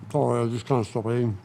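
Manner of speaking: talking while chewing; mouth full. Real, not acted. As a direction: speak through a mouthful of food. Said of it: "shouldn't talk with my mouth full".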